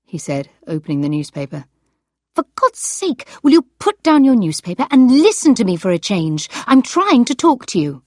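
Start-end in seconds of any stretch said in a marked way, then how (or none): 2.3-8.0 louder, exasperated and sharp. Acted. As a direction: demand his attention, fed up. Wife snaps at newspaper-reading husband.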